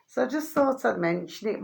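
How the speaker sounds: hoarse, tired voice while sipping coffee. Real, not acted. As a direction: speak through a hoarse throat. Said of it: "I'm getting a hoarse voice now".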